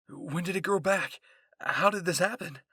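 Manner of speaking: loud, high, disbelieving questions; a shocked outburst; stunned. A narrator's reading. Historia stunned at the regrown arm.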